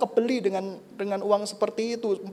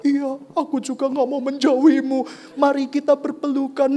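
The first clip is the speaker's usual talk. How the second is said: higher, louder mock-romantic reply; imagined soppy God; 7 semitones higher, 6 dB louder than usual. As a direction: answer like a melodramatic lover. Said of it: "dia nggak jawab saya".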